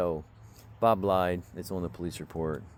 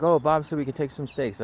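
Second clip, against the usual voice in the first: mock excuse; 7 semitones higher, 5 dB louder than usual. Voicing the women's excuse.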